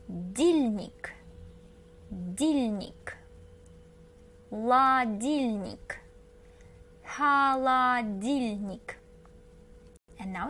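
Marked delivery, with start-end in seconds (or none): 0.1-6.0 slow, drawn-out Ладильник; slow building chunk. Shown as a demonstration. Teacher building the word from its end. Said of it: "And then start from the end". 7.0-9.0 slow whole Холодильник after building up; slow full word. Shown as a demonstration. Teacher finishing the built-up word slowly. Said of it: "And then start from the end".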